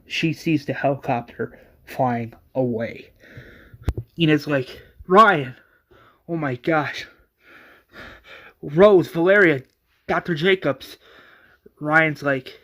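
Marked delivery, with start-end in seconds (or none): 5.1-11.0 higher, louder calling of names; dismayed exclamations; alarmed discovery. Acted. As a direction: call out in acted shock. Enid finds her friend wounded.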